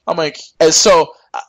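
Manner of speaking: normal talking voice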